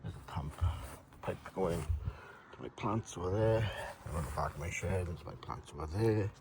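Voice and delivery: normal talking voice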